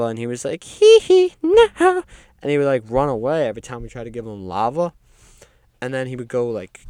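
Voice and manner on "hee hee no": loud falsetto squeal. Retold or imitated. Pop star turns down the lava, squealing in falsetto. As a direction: refuse in a very high mock pop-star voice. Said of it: "making weird noises all day".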